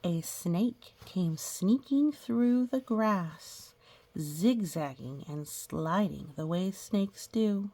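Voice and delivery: slow, drawn-out storybook reading; reading to children. Acted. Teacher reading a picture book to the class, storybook voice. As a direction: read it like a picture book for little kids.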